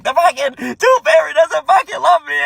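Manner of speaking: put-on high, loud distraught child voice; whiny kid. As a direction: wail it like a heartbroken kid. Kid distraught over tooth fairy.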